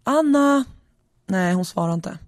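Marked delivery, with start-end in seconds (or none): 0.0-0.7 higher-pitched call to his wife; acted husband's shout. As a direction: call her name as if into another room. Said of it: "Och så ropar han".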